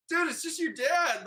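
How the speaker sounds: retold disbelief that it is only a dad; exasperated disbelief. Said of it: "And I'm like".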